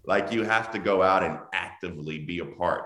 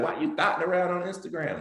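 high mock-accusing wife voice; mock scolding wife; 11 semitones higher than usual. Wife teasing husband about shirtless gym photos, high mock scold. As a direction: play the suspicious wife, pitched up high. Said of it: "My wife will be like".

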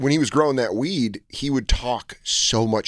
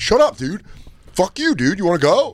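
high, loud picking a fight; hammed-up young hothead ready to brawl; teen self mocked as a hothead, shouting; mock aggressive shout; 9 semitones higher, 3 dB louder than usual. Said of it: "nineteen-year-old me was real quick to get to that".

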